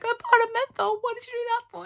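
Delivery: very high, very loud mock shriek; shrieking nurses. Nurses shrieking at the patient, very high and loud. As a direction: shriek it in exaggerated horror. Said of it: "And they're like".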